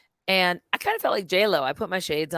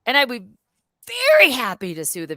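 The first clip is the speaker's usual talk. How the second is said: a hint of gleeful scheming; astonished glee; 7 semitones higher than usual. Realizing she has the footage, higher delighted disbelief. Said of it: "I'm like".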